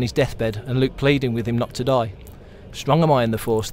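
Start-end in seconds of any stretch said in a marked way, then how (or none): none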